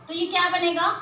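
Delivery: ordinary delivery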